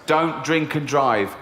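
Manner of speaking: slow, deliberate slogan; slogan read. Retold or imitated. Christmas campaign, slow and deliberate. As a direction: spell it out like a campaign line.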